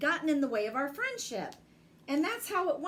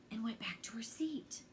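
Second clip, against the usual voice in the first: breathy voiceless hush; soft classroom whisper; 4 semitones lower, 10 dB quieter than usual. Acted. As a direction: tell it like a secret in class.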